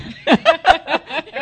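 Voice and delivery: high bright laugh bursting out; burst of laughter. Real, not acted. Telling a friend about the call, bursting out laughing. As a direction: laugh at how they knew you already. Amused at being looked up.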